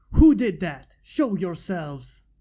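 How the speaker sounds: loud angry shout at the attackers; screaming. A narrator's reading. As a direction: scream it out, demanding answers. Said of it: "screamed Steam Lord".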